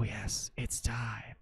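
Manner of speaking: whispered, soft, building suspense. Acted. Streamer teasing raiders with a hushed build-up. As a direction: breathe it like a secret before the reveal.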